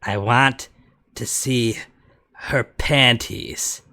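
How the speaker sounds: slow creepy quote. Retold or imitated. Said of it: "that was Somnium Files".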